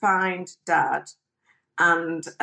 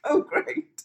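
higher, laughing; laughing sarcastic; 12 semitones higher than usual. Retold or imitated.

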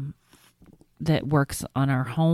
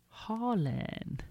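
put-on toddler voice; 7 semitones higher, 11 dB quieter than usual. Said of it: "And he finally said".

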